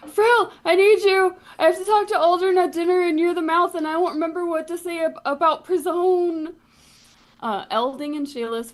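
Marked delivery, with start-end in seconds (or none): 0.1-6.5 high, loud, panicked shouting; yelling through a door. Acted. Dam yelling to Frill through the bath keyhole, frantic shout. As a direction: bellow it through the keyhole in a rush. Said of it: "yelling through the keyhole".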